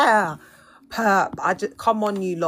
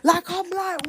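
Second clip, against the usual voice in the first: ordinary delivery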